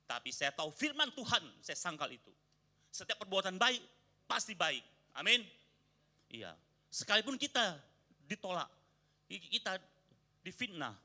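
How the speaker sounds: loud, high sermon delivery; fiery preaching. Acted. Pastor explaining the proverb to the congregation, fiery preaching. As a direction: preach it out to the whole room.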